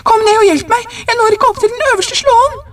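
very high, urgent call for help; strained, breathless shout. A narrator's reading. Wife calls husband to help with the bolt, strained high cry. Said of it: "anstrengt og stakkhåndet".

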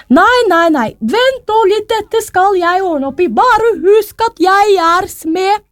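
high-pitched, emphatic storybook mother; resolute mother. A narrator's reading. Mother promises her daughter she will fix it, high emphatic voice. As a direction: take charge as an outraged mother, high and forceful.

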